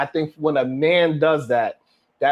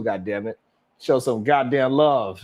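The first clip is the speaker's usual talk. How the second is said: ordinary talk, nothing marked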